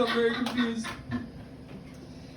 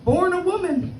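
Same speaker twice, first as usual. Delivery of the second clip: much higher voice of doubt; higher mock shocked asker; incredulous question; 8 semitones higher than usual. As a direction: ask it higher, baffled. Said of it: "people are like".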